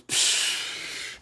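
long, soft hissing puff of air; wordless blown-out breath, imitating exasperation; imitated mouth blow. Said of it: "Wee-Bey just goes".

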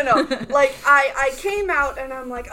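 ordinary delivery

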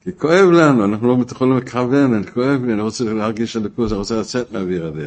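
loud, high start, easing as it runs on; impassioned plea from hurt; pained outcry. Acted. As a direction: cry out, then pour it out. Voices the pained cry in prayer.

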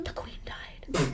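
slow stunned whisper; stunned whispered realization; hushed shock. Real, not acted. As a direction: whisper the news in disbelief. Shocked realization, then repeated.

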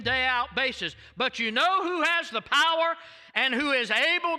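rising, forceful sermon voice; preaching. Acted. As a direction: build it up to a preaching peak. Preaching builds to climax.

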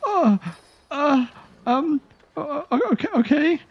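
high, panicky elf voice; frightened elf captive. Acted. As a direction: stammer it out as a terrified prisoner.

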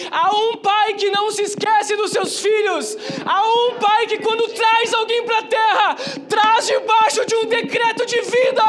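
loud, high fervent preaching shout; preaching at full force. Real, not acted. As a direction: build to a shouting climax. Preaching climax.

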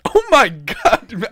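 drawn-out, high, loud oh my God; loud, high-pitched real astonishment; astonished groan. Real, not acted. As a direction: exclaim in disbelief, stretching it out. Reacting to the short runtime.

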